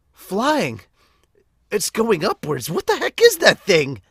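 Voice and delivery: high, loud, frightened shonen hero; a boy hero spooked by glowing bones, rising to shouts; squeaky high startled hero voice; anime hero, alarmed. Acted. Voices young hero, scared then startled.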